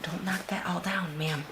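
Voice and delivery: soft whispered scolding; whispering. Real, not acted. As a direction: whisper a gentle telling-off to the dog. Scolding the dog softly.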